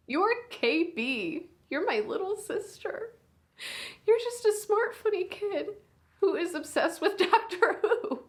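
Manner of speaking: high and tearful, near sobbing; crying while praising your little sister; voice breaking. Acted. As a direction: fight the tears as they crack through.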